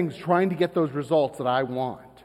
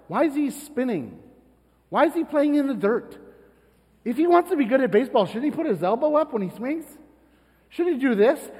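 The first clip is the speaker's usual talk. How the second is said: high, louder, rushing fretful questions; fussy sports dad; 9 semitones higher, 3 dB louder than usual. Retold or imitated. Dad fretting at son's practice, high anxious questions. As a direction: nitpick like an anxious baseball dad. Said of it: "I'm that dad who's like".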